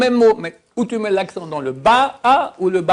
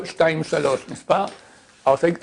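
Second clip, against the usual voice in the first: usual speaking voice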